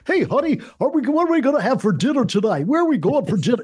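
high, hammed-up announcer delivery; announcer voice at home. Announcer asking honey about dinner at home, hammed-up announcer voice. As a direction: keep the mock broadcast voice up throughout. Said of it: "they don't talk like that at home".

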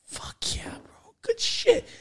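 whispered, drawn-back cheer; hushed mock approval. Suggesting how the visitor should have reacted, hushed approval. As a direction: whisper it like a private congratulation.

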